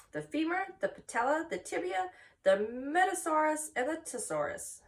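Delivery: high, listing each part with pride; a clever spider showing off her anatomy; proud Charlotte. Acted. Charlotte lists leg parts proudly.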